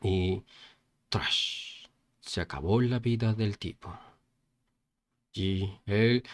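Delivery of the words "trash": voiceless hissed stab effect; soft whooshing impact with the mouth; imitated slash. A narrator's reading. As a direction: make an unvoiced slicing noise. Imitates the final blow.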